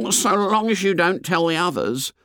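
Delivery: high-pitched schoolboy character voice; fat boy's voice. A narrator's reading. Piggy asks Ralph not to tell others, high boyish voice. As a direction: ask it as a boy, pitched well up.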